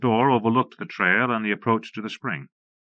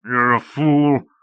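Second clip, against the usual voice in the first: grumbled; 3 semitones higher, 6 dB louder, much slower than usual. A narrator's reading. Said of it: "somebody grumbled".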